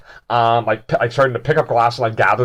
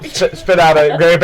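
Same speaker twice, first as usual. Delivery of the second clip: ordinary delivery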